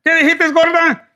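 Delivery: high, loud mock outrage; jealous boyfriend. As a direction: snap back at your girl. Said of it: "your dude's like".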